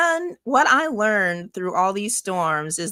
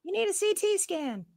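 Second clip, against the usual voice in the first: mock doctor voice; 11 semitones higher, 7 dB quieter than usual. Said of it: "mock doctor prank call".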